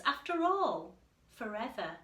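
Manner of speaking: plain reading voice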